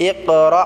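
ইকরা with the রা bounced sharply; jolted ra. Shown as a demonstration. Teacher showing students the jolted রা in ইকরা. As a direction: snap the রা in ইকরা with a jolt. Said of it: "এটা ঝটকা দিয়ে উচ্চারণ করতে হবে।".

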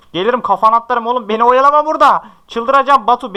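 mock-furious gamer rant; frustrated yelling. Real, not acted. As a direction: yell at the player to stop stalling. Yelling at stalling opponent.

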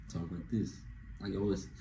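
very soft, low murmur; hushed, deep, smooth voice; code-switched voice for girls. Shown as a demonstration. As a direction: drop low and soft, talking to a girl. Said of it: "I just code switch".

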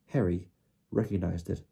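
usual speaking voice

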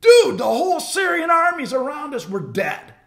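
high panicked shout; freaking out. Retold or imitated. Servant sees the army at the window and yells in panic. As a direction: yell it like a terrified servant seeing an army. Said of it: "He's freaking out".

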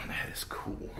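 a soft whisper of approval; whispered awe. Real, not acted. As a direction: whisper it to yourself. Host admires the cabinets.

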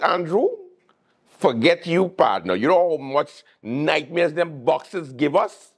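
lightly voiced gloating tax office worker; a hint of a boastful clerk, high. Tax office worker taunting Andrew over boxes, gloating. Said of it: "tax office workers like".

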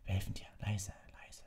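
soft, breath-only coaxing whisper; urgent hiss. A narrator's reading. Harry coaxing the hippogriff away, hissed whisper. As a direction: whisper urgently, keep it hushed. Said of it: "zischte Harry".

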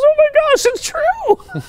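very high, loud, amazed gasp of a voice; high-pitched amazed woman's cry; astonished squeal. Retold or imitated. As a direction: squeal it in disbelief. Woman's astonished reply on phone.

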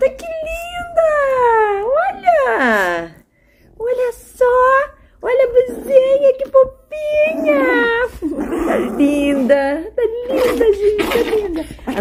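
high squealing praise. Real, not acted. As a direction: gush over how cute she is. Gushing over dressed dog.

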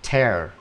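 "Tear" with an open e, rhyming with "air"; TAIR, demonstrated on its own; tear read as TAIR. Shown as a demonstration. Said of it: "mà đọc là".

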